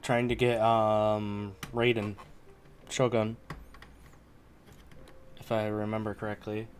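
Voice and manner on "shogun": ordinary talk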